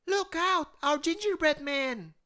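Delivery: put-on very high, far child voice yelling; yelling little boy. A narrator's reading. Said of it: "yelled the little boy".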